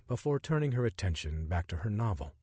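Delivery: ordinary delivery, nothing marked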